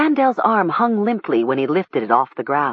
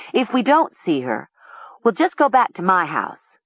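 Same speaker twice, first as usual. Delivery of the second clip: ordinary delivery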